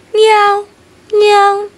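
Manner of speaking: high, bright cat meows. Acted. The pet cat meowing at Ching in the dark, high mew. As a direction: meow like a little cat.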